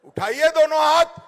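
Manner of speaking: loud rousing call; booming political rally speech. Acted. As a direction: push the crowd to shout.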